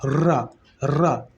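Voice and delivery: रा, रा with the tongue rolling fast; trilled ra demo. Shown as a demonstration. Teacher demonstrating the trilled ra to students, slow rolled r. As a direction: roll the r in रा, रा slowly and clearly. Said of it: "बहुत तेजी से लड़खड़ाती है".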